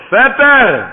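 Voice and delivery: loud, high cry to an uncle; calling out. Acted. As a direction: act out a nephew's emphatic answer. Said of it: "אז הוא ענה לו".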